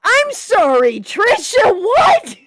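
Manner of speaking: very high, loud disbelief; shocked. Real, not acted. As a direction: blurt it out stunned. Reader reacts in shock.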